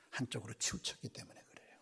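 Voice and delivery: hushed sermon whisper; confiding whisper to the congregation. Real, not acted. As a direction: lean in and whisper it. Grave point to congregation.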